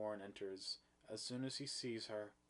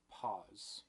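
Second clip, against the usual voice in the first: ordinary delivery, nothing marked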